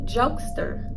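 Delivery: a whispered, teasing jokester; whispered tease. Pretend. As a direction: tease under your breath. Mocking whisper.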